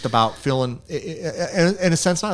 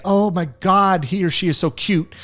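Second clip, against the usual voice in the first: fake gushing; 7 semitones higher than usual. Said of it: "instead of saying".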